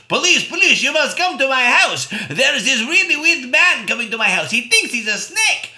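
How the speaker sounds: loud, high panicked plea; panicked call. Retold or imitated. Babysitter phoning police, loud high panic. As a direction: beg the police for help. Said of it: "She phones the police".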